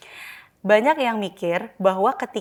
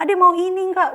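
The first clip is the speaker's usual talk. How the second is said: pitched even higher and louder; higher, louder parent pressing a crying child; 8 semitones higher, 5 dB louder than usual. Shown as a demonstration. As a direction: push the question up higher still. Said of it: "Lebih tinggi nadanya".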